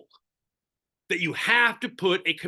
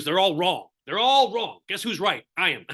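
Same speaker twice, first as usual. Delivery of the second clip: ordinary talk, nothing marked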